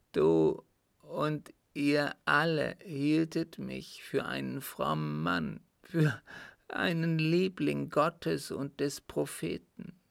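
put-on halting, laboured confession of a guilty old man; agonised appeal to his son, weighted with guilt; anguished dying father. Sick father confessing to his son Arthur, anguished.

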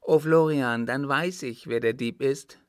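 put-on little boy crying out with joy, high and loud; joyful exclamation. A narrator's reading. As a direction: burst out with a delighted, high-pitched discovery. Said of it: "schlug der Kleine vor Freude jauchzend".

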